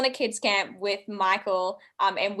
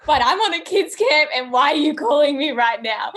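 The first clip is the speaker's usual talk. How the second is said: high-pitched, louder mock complaint; mock exasperation; 7 semitones higher, 5 dB louder than usual. Woken camp leader complains to calling friend, mock exasperation. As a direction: protest the early call, pitched up. Acted complaint at early call.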